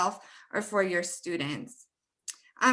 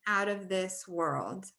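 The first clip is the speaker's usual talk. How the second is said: "out of this world", slow and softer, long WORLD; phrase stress; 3 semitones lower, 5 dB quieter, slower than usual. Shown as a demonstration. Teacher repeats phrase example, "world" stretched in "out of this world". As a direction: linger on "world" in "out of this world". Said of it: "one word that's stressed".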